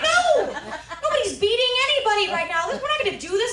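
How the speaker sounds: very high, loud, rapid protest; acted panicky objection, loud and high; frantic refusal. Granddaughter refusing loudly.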